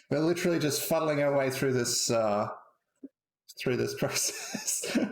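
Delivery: high laugh breaking into the last word, which slows down; chuckling at his own fumbling. Real, not acted. Laughing to the chat about fumbling through the setup.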